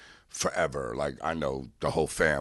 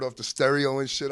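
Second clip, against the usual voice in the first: ordinary talk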